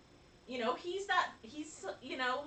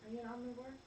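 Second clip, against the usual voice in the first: ordinary talk, nothing marked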